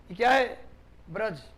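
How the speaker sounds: ordinary talk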